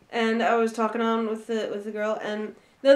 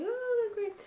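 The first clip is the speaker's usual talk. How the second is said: high-pitched, softer mock pleasantry; retold polite enthusiasm; 12 semitones higher, 7 dB quieter than usual. Retelling small talk at the haircut, mock polite enthusiasm. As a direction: react with put-on, high, soft delight.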